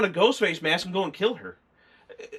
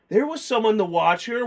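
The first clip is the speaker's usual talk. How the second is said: ordinary talk, nothing marked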